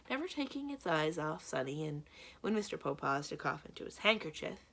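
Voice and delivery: plain reading voice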